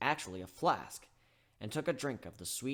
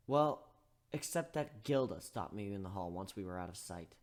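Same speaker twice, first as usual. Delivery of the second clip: usual speaking voice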